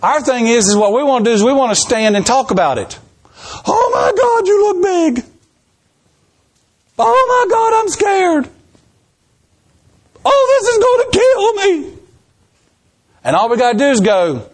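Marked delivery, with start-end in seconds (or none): 3.7-12.0 high squealing mock panic, cry in the voice; mock terrified. Preacher mocks scared believers with a squealing panicked cry. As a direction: whimper like a coward facing a giant.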